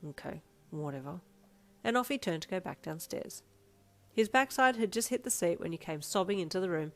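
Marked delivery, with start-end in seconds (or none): 0.1-1.2 soft, low brush-off; quiet low shrug; muttered. A narrator's reading. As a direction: dismiss it under his breath. Husband muttering to himself.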